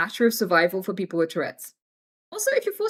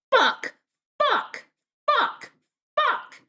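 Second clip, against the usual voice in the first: put-on high, squeaky toddler swearing; tiny high-pitched kid voice; little toddler; 13 semitones higher, much slower than usual. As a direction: imitate a small child, one short word at a time. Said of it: "I was, like, a little toddler".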